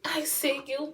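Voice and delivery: gasp, then high and slow with delight; excited gasp. Real, not acted. To the Glam Goddess for her gift, high gasping delight. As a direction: swoon over the gift.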